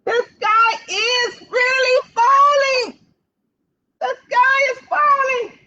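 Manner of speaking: high, slow, loud crying wail; wailing chick. Acted. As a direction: wail it like a scared little hen. Chicken Little shouting.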